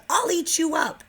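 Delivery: high, loud shout; yelled. Acted. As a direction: shout it like a wild little boy. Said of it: "And Max yelled".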